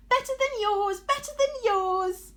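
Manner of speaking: very high, loud singsong taunt; taunting shout. A narrator's reading. Arachne taunting the goddess, shrill chanting shout. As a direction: chant it at her like a playground jeer. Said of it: "Arachne shouted".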